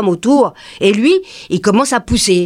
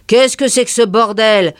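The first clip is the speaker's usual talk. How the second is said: usual speaking voice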